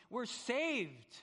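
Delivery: high, loud cry of relief; elated castaway cheer, pitched high; joyful shouting. Acted. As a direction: shout the news that rescue has come. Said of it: "You are actually shouting".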